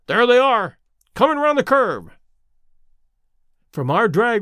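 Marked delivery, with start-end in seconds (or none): 0.1-2.1 high, loud call at sighting them; sudden cry. A narrator's reading. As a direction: call out the race excitedly. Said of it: "he cried suddenly".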